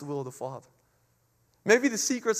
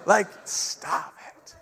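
mock grumble; 3 semitones lower, much slower than usual. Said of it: "I'm like".

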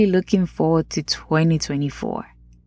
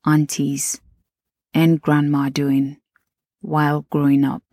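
usual speaking voice

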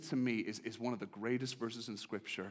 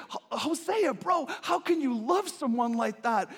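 much higher, louder disbelieving buddy voice; incredulous bro; 14 semitones higher, 11 dB louder than usual. Acted. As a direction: ask it like a stunned friend. Townspeople question Hosea.

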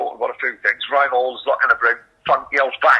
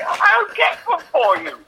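higher, slow, put-on posh phone voice; 12 semitones higher than usual. Mocking his mate's posh voice to a Doncaster girl, slow and high. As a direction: ham up a posh telephone manner. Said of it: "proper phone voice".

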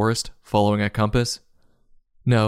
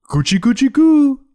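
put-on high, loud baby-talk coo; mock tickling; 7 dB louder than usual. Reviewer mocking the tickling, high loud baby talk. Mimes tickling with feather duster.

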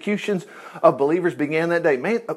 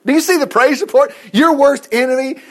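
usual speaking voice

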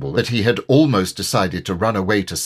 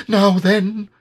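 Piglet voice; 11 semitones higher, 5 dB louder than usual. A narrator's reading. Piglet voiced, bracing himself.

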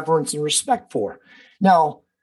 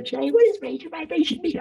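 much higher voice, mock puzzled asker; curious questioner; 11 semitones higher than usual. People asking the podcaster what he means, mock puzzled. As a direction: play a puzzled fan asking a question, pitched way up. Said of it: "when people say".

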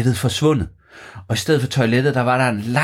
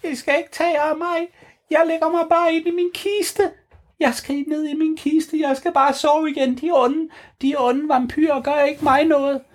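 high relaxed vampire count; high Grev Bo voice. Acted. Grev Bo reassuring the kids, high and calm. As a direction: calmly shrug off the danger.

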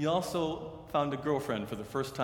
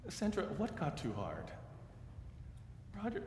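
hushed, much softer broken phrases with long pauses; teary retelling; 8 dB quieter than usual. Retold or imitated. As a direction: quietly voice a grieving mother's tears. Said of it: "Her voice was teary".

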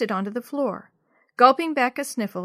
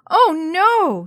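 loud, high cry of dismay; wailing; 8 semitones higher, 10 dB louder than usual. A narrator's reading. Jenny sees her pet in danger, wailing. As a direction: wail in horror, drawn high. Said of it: "she wailed".

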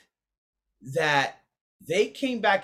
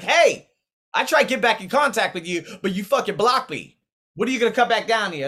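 mock scolding; 6 semitones higher, 6 dB louder than usual. Voicing Duke's message.

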